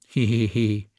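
dry toneless crone chuckle; old woman's toneless he he he. A narrator's reading. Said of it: "curious, toneless chuckle".

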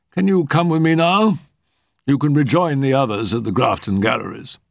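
high-pitched gentleman with a polite chuckle; high, formal character voice; courteous, authoritative uncle. A narrator's reading.

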